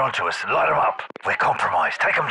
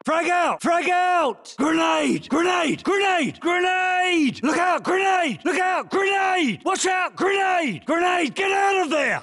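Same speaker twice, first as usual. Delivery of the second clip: shouted warning; 9 semitones higher, slower than usual. Acted. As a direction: yell it so the squad dives. Grenade warnings, combat barks.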